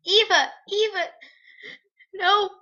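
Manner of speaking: high, loud crying scream of a name; anguished shout. Acted. Girl screams for her dead friend, loud and crying. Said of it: "I shouted again".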